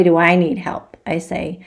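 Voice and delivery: usual speaking voice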